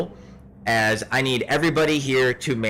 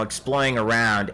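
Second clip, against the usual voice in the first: ordinary talk, nothing marked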